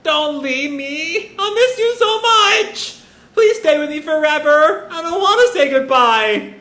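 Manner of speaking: very high, drawn-out wail; hammed-up bawling like a small boy; childlike mock sobbing. Eight-year-old begging Luffy to stay, mock sobbing. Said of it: "as he just sobs".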